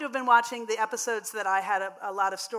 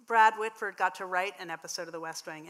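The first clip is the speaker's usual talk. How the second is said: usual speaking voice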